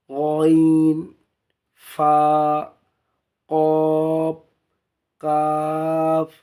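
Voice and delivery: slow, sustained letter names on a level pitch; drawn-out recitation. Shown as a demonstration. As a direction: stretch each Arabic letter name long and even. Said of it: "একটা টানা পড়ে আপনাদের শোনালাম".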